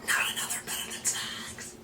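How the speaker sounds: soft groaning whisper, mock despair; exasperated whisper. Groaning over yet another mascara, whispered exasperation. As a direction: sigh it out, fed up. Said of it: "But I'm like".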